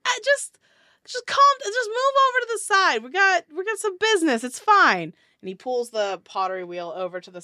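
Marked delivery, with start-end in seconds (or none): 0.1-5.1 much higher, louder, fast brush-off; dismissive dwarf. Acted. Dwarf brushes off the driver, high, loud and fast. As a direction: wave her off quickly. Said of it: "the man on the ground's like".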